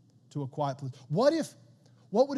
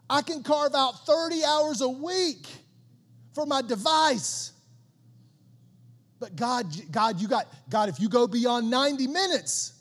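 loud, high-pitched comic protest; mock indignation; 5 semitones higher, 4 dB louder than usual. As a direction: complain in hammed-up outrage at God. Mocks churchgoer grudging God time.

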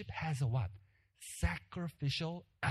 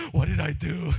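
shocked; 8 dB louder than usual. Retold or imitated. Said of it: "He was in shock".

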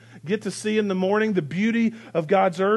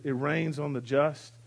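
ordinary talk

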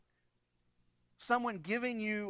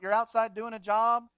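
ordinary talk

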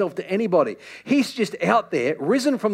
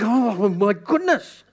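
mock exasperation; much slower than usual. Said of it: "I was like".

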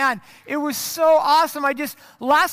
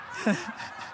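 a short laugh with almost no voice; quick airless chuckle; silent breathy laugh; 10 dB quieter than usual. Real, not acted. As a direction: let a laugh escape, mostly air. Reacts to the sign photo.

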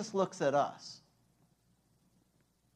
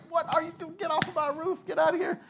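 high, pitched-up pretend yell; mock shout; 12 semitones higher, faster than usual. Preacher pretending to run upstairs at the intruders, mock high shout. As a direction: chase them off the roof in mock anger. Preacher imagines chasing men off roof.

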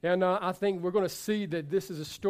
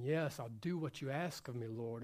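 much softer, hushed surrender; quiet prayer; 8 semitones lower, 12 dB quieter than usual. Retold or imitated. Man surrendering to the Lord, hushed. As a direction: murmur it as a vow.

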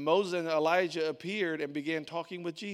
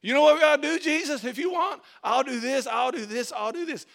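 much higher, loud, blurting excitement; eager Peter; 8 semitones higher, 9 dB louder than usual. Retold or imitated. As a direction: pitch every idea at once, overeager. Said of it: "he just throws out his opinion".

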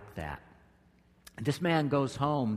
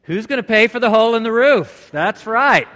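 much higher, much louder mock indignant shout; mock outrage; 9 semitones higher, 15 dB louder than usual. Pastor voicing the congregation's first thought, mock outraged shout. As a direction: blurt it out like a stingy homeowner. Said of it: "What is your thought, first thought?".

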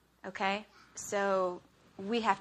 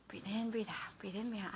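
soft muttered breathing mantra; self-calming; 9 dB quieter than usual. Retold or imitated. Wife so mad at husband, calming herself softly. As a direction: talk yourself down under your breath.

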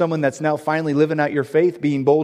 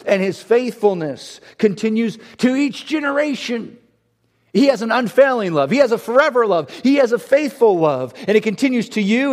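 louder, higher, rousing sermon voice; fiery pulpit declaration; full-force preaching; 6 semitones higher, 5 dB louder than usual. Acted. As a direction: build it up like a preacher at the peak.

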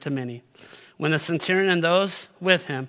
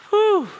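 high, loud "whew"; 9 dB louder than usual. Real, not acted. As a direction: let out a high whew of relief from tension. Exhales after a heavy passage.

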